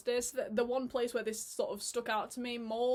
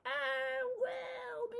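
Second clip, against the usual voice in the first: very high, drawn-out doubtful noise; hesitant squeal. Acted. Reviewer voicing her doubts about the casting, high hesitant squeal. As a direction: squeak out your reluctance, stretching it high.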